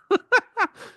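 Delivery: sudden high burst of laughter; loud laughing fit; big laugh. Real, not acted. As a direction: crack up loudly, high and loud. Laughing at referee story.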